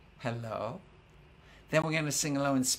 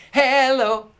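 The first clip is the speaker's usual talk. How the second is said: loud call; 9 semitones higher, 14 dB louder than usual. Shown as a demonstration. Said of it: "I'll sing 'em".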